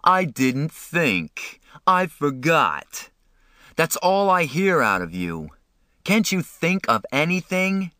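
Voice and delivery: high, slow mocking of excuses; berate, stretching each excuse out; angry shouting. A narrator's reading. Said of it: "Derry shouted".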